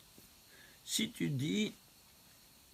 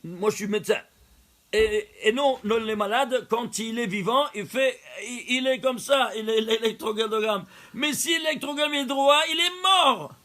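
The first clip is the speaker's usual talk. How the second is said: higher and louder, building to a cry on mort; a doctor proving his point with rising heat; excited doctor; 6 semitones higher, 6 dB louder than usual. Retold or imitated. Doctor arguing with the rabbi, rising excitement. Doctor explains the heart trace.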